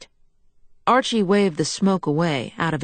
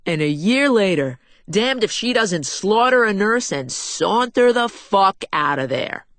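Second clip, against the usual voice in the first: put-on brash older man bragging, a touch raised; a hint of the swaggering host showing off his guest; mild gruff bravado; lightly voiced boastful crime boss; 4 semitones higher than usual. A narrator's reading.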